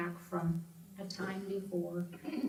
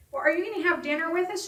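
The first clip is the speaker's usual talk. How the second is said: higher, fast, bright invitation; cheery staff; 9 semitones higher, 4 dB louder, faster than usual. Retold or imitated. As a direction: chirp it like eager staff. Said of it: "One of the staff people said".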